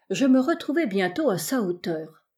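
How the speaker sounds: ordinary delivery, nothing marked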